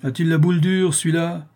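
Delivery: impatient, mocking question; scoffing bailiff. A narrator's reading.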